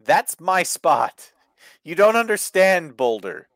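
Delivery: high, pleading mock protest; mock aggrieved wrestler. Luchador begging Boulder to leave his moves alone, mock aggrieved. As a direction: plead like a hammed-up put-upon wrestler.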